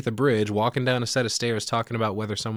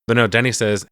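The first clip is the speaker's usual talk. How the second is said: ordinary talk, nothing marked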